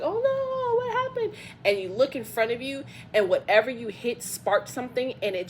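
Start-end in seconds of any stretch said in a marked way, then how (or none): 0.0-1.3 high-pitched mock panic; sudden alarmed squeak; startled. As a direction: yelp it like the ground just shook under you. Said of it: "You're like".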